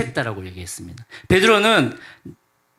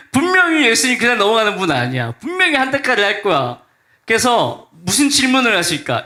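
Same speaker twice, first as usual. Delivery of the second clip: higher and louder, fretting out loud; anxious inner panic; 7 semitones higher, 3 dB louder than usual. Pretend. Peter dreading Jesus' rebuke at breakfast, panicky and raised. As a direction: panic about the coming scolding.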